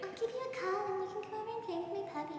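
put-on high, fast, sing-song girly voice; mimicking a dreamy college acquaintance; imitating the college girl. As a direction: rattle it off like a sweet odd girl. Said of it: "inspired by this girl that I kinda knew".

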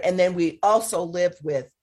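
ordinary talk, nothing marked

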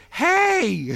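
put-on sudden high, loud hey of an excited small boy; little boy's shout. Little boy on an SUV greets a passing stranger with a high, loud hey. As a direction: call it out like a kid spotting someone. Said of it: "and he goes".

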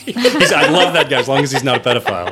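a real laugh spilling into the line; amused, laughing disbelief. Real, not acted. Laughing at friend's low bar.

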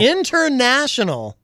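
high, stretched-out INTERNATIONAL; grand proclamation. Real, not acted. Repeats the word grandly.

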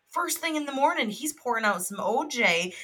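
ordinary talk, nothing marked

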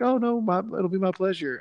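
high, sweet customer-service voice; sugary cashier voice. Retold or imitated. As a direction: gush it out like an eager cashier. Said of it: "They're like".

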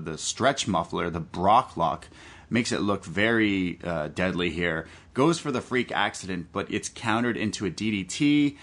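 ordinary delivery

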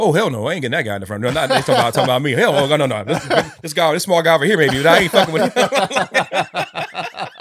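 high, loud comic protest; imitating an emphatic, high-pitched refusal; acted loud refusal. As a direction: refuse loudly, playing the alarmed comedian.

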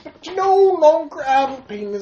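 loud mock celebrity voice with stretched words; Bill Cosby. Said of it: "Did he really talk like that?".

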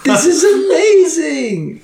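squeaky high character voice; high NPC voice. Acted. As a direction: pitch way up, drawn out.

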